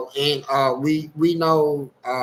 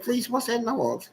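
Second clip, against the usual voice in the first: soft high-pitched imitation of a woman; 7 semitones higher, 5 dB quieter than usual. Pastor hearing a noise from her office, high-pitched voice. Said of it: "she got a high-pitched voice".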